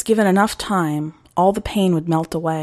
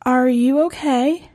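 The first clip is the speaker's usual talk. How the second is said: higher, slow, concerned; worried girl; 7 semitones higher than usual. Acted. Girl checking on her shaking brother. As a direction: ask him gently, fearing the answer.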